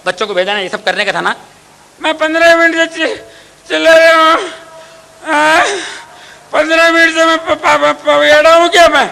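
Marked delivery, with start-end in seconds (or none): 2.0-9.1 high, loud sputtering complaint; sick husband stammering that he has shouted for fifteen minutes; stammering angry husband. Acted. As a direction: splutter with rage.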